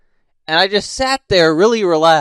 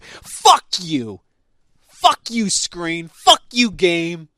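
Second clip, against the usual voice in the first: mock cursing at screen; much slower than usual. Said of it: "I'll be playing a game".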